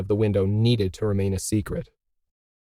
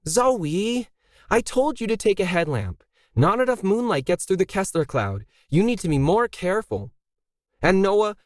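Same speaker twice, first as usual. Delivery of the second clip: higher voice, a firm, motherly scold; Emily, brisk facility owner; 8 semitones higher than usual. A narrator's reading. Emily scolding Zoe and Noah before launch, higher and firm. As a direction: take charge and hurry them along.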